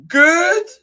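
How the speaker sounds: very high, loud squeak of a question; piercingly high little mouse voice; indignant mouse. Acted. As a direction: squeal it back in disbelief. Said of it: "said the mouse".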